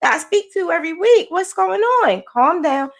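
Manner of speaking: worried therapist. Retold or imitated. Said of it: "she just kept saying".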